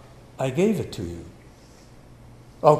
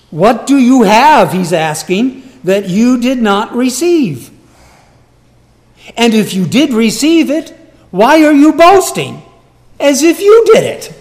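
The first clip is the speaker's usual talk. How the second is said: louder and higher to a peak; pressing challenge; 7 semitones higher, 9 dB louder than usual. Acted. Paul challenging boasters, climbing loud. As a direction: drive the question up and up. Paul's question preached loudly.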